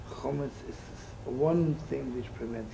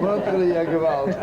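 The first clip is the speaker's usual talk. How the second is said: mock lament; 3 semitones higher, 8 dB louder than usual. Mock lament over the rich man.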